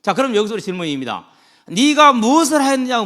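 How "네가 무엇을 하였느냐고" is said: loud stern question. Retold or imitated. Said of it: "묻습니다".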